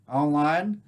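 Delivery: ordinary talk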